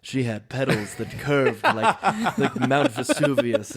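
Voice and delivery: slow, low hard-boiled narration; deadpan noir voiceover, drawn out; noir detective. Retold or imitated. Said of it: "he walks into the room and he sees a flower".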